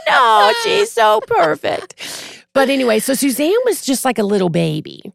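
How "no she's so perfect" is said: high, slow, drawn-out gushing protest; mock protest. Mother defends her baby from nephew's alien joke, mock gushing protest. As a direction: imitate a doting mother, pitched way up.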